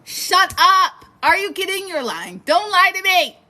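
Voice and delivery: high, loud shrieking disbelief; excited disbelief. Real, not acted. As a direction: yell it in happy shock. Viewer shares her birthday.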